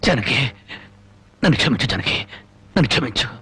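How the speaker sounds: low, heavy begging for forgiveness; remorseful plea. Acted. Husband begging his wife to forgive him, low remorseful plea. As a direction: plead low and broken.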